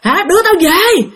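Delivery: high, harsh-edged mock outburst; retold cursing demand. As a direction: imitate a possessed child demanding angrily. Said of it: "Nó chửi mà nó dạng như chửi thề".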